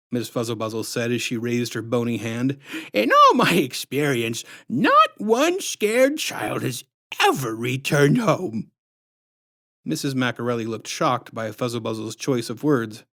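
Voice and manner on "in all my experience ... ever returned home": high, slow sugary put-on voice, far above the narrator; sickly sweet menace, drawn out; syrupy sinister clown woman introducing herself; creepy clown lady. A narrator's reading.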